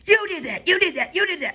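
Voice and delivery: high, shrill-pitched retold accusing; mock accusation. Retelling the woman blaming her, mocking accusation.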